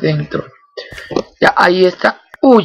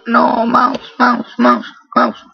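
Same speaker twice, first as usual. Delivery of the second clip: higher pleading mouse mouse; frustrated calling; 7 semitones higher, much slower than usual. Real, not acted. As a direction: call the mouse like it won't listen. Mouse stops working mid-game. (llamando al mouse, frustrado y más agudo)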